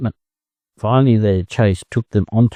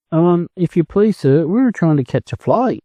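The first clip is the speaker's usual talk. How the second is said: timidly; 8 semitones higher than usual. Acted. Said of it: "Quick replied timidly".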